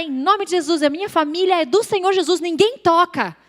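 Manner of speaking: high, retold panicky proclamation; frantic declaration. Frozen woman claims her family for Jesus, high urgent declaration. Reage parada, declara proteção sobre a família.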